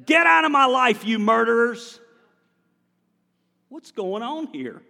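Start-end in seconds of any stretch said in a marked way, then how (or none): 0.0-2.0 loud, high angry command. Acted. Preacher reading David's words angrily to the congregation. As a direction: throw them out in anger. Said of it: "I'm reading this angry".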